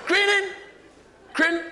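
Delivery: very high garbled English sounds; baffled, high-pitched parroting; mimicked incomprehensible English. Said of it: "on dirait du basque".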